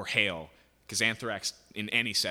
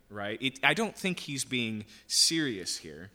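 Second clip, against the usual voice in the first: normal talking voice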